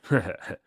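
short gloating laugh; brief mocking laugh; villain's chuckle. A narrator's reading. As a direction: chuckle briefly at your own threat. Psychopath chuckles after his threat.

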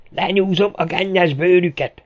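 high-pitched, raging threat; furious shout. A narrator's reading. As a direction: yell out a vow of revenge. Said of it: "ordította Volodya". (dühödten ordítva)

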